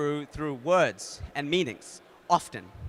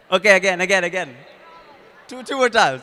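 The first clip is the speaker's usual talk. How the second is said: much louder, higher; rally the room to go again; loud game host urging another round; calling out; 4 semitones higher, 10 dB louder than usual. Real, not acted.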